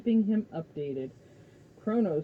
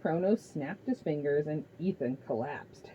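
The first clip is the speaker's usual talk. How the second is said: ordinary delivery, nothing marked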